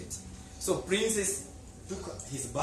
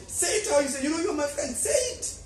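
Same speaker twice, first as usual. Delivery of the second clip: eager urging; 10 semitones higher, 3 dB louder, faster than usual. Acted. Urging friend to speak.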